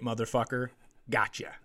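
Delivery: ordinary talk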